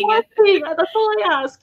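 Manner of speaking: high, loud playful plea; mock begging. Guest joins in begging the characters, mock pleading. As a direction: play along with a hammed-up plea. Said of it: "authors doing the begging".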